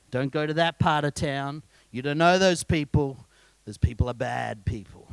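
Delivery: a hint of a parent warning a child; parent's warning. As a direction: parents warning kids off that part of town, low and lightly voiced. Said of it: "told by their parents".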